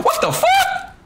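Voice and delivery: very high-pitched squealed disbelief; real high-pitched shriek of shock; shocked outburst. Real, not acted.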